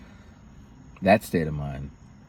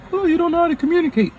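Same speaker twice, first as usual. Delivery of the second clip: high, fast mocking whine; faster than usual. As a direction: toss the jab back sarcastically. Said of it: "it usually gets thrown back at me".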